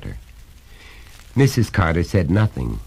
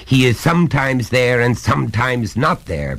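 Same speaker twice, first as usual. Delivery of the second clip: higher, louder, hammering each point; stern father, pitched up and insistent; exasperated lecturing father; 8 semitones higher, 4 dB louder than usual. Acted. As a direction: lay down the law to a small son.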